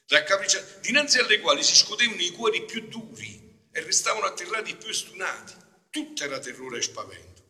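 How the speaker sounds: high, loud reading of a terrible vision; dramatic reading. Acted. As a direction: read it with dread and weight. Reads the vision with force. (lettura drammatica)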